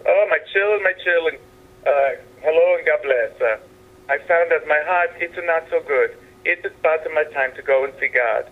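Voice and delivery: put-on Italian-accented Pope blessing the crowd; mild Italian Pope voice in a joke.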